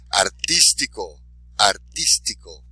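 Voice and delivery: Artístico, slow, with a loud "tís"; stress on accented syllable. Shown as a demonstration. Teacher modelling for repetition, Artístico slowly stressed on "tís". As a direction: draw out Artístico and punch "tís". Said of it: "be sure to emphasize the accented syllable".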